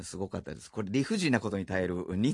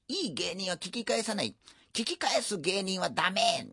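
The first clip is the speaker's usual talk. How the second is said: badly done Kin-chan, pitched higher; a poor attempt at Kinichi Hagimoto; Kin-chan impression; 9 semitones higher than usual. Quoting Kin-chan's advice in a bad impression. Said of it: "似てなさすぎて".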